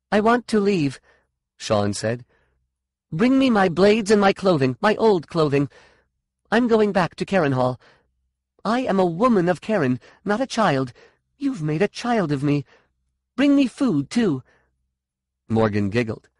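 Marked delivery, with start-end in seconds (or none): 0.1-1.0 high, insistent demand; firm and urgent; defiant demand. A narrator's reading. 3.1-14.4 high, forceful ordering; raised, defiant voice; angry demands. A narrator's reading.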